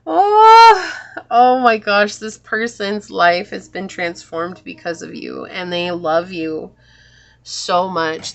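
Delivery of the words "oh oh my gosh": high, loud cry of being moved; overwhelmed gasp. Real, not acted. Reader moved by the message, overwhelmed cry. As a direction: burst out, deeply touched.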